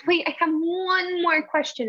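lightly voiced child drawing it out; imitating small daughter. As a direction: play the stalling four-year-old. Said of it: "and she goes".

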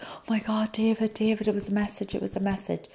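high, breathless-rush retelling of a revelation; retold excited realization. Retelling her sudden realization.

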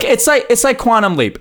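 eager burst. Real, not acted. Excited interruption to keep talking.

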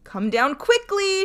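shouted high and loud; furious shout. A narrator's reading. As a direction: yell up the stairs in a rage. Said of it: "shouted Bluebeard".

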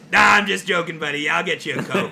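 loud joking server. Retold or imitated. Server teasing the customer about his Coke, loud and gleeful. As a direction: ham up the server's gotcha.

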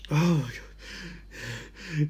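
very slow, soft dread; fearful hush. Acted. Scared host alone outside, hushed dread. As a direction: breathe it out in dread.

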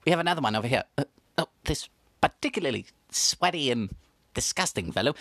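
usual speaking voice

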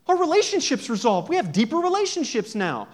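high, loud show of delight; exaggerated cheering. Shown as a demonstration. Preacher celebrating resolved relationships for the congregation, high and excited. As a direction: keep the hammed-up celebration going.

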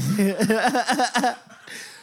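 long, high, loud put-on laugh; mocking imitated laugh. Capping the retold jibe at John, high mocking laugh. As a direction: imitate a taunting laugh in a rapid run.

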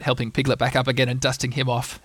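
ordinary delivery

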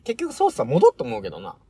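ordinary delivery

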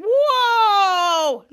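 high, loud gasp of wonder; a big awestruck whoa; amazed whoa. Acted. Kids amazed by the sculpture.

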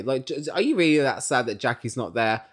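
usual speaking voice

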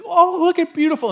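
put-on small child amazed at bubbles; little kid voice. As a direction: coo it like a delighted toddler. Dad voices his small kids.

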